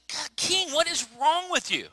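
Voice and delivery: high, loud scolding cry; exasperated rebuke. Acted. Daniel scolding King Belshazzar, high and exasperated. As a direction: throw up your hands at the king. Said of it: "Daniel is like".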